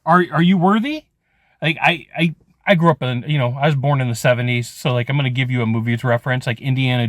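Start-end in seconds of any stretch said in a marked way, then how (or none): 0.1-1.1 higher, slower challenge from above; a lightly voiced cosmic questioner testing you; universe asking. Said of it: "the universe is asking".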